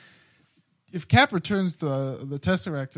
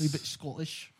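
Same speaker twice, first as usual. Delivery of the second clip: bad Scottish accent, soft; 11 dB quieter than usual. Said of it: "That's a terrible".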